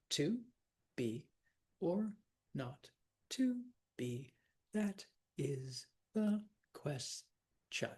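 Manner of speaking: "To be or not to be. That is the question." one syllable at a time, slowly; very slow, a separate beat on every syllable, "ques-tion" split in two; counting syllables. Shown as a demonstration. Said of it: "Let's count 'em".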